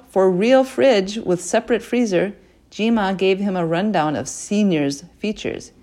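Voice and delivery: plain reading voice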